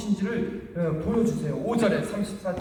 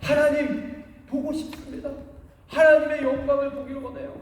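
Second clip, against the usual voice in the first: higher, louder, longing request; eager plea; 7 semitones higher, 3 dB louder than usual. Retold or imitated. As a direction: seize the chance and beg to see God. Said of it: "찬스를 잡고".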